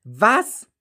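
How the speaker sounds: high, startled cry. A narrator's reading. Child learns the whole Earth is in danger, startled cry. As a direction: jolt at the news. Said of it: "rief ich erschrocken".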